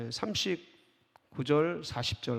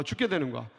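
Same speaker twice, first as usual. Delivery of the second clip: ordinary talk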